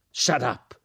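the sergeant snaps "Shut up!" at him; shout. A narrator's reading. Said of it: "gridò il sergente".

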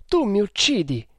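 anguished cry, pitched high; sobbing. A narrator's reading. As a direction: cry out as if through sobs. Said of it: "esclamò singhiozzando". (come singhiozzando)

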